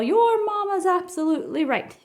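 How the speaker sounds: hearty father bear chiming in; lightly voiced eager papa bear. A narrator's reading.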